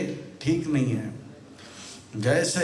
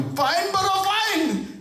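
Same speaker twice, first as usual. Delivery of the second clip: high, acted shouted demand; hammed-up angry order, pitched way up; official demanding payment; slower than usual. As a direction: shout the penalty at an offender. Acted government demanding fine.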